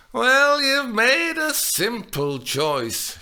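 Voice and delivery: put-on high, slow, loud sly old nurse; imply she's simple-minded; the nurse mocking Juliet's choice. A narrator's reading. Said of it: "as if she meant ... simple-minded".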